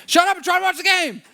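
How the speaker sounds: high, loud hammed-up yell at the kids; mock-exasperated shout. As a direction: play a frustrated dad yelling. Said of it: "you're yelling at your kids".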